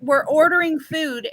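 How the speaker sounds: ordinary talk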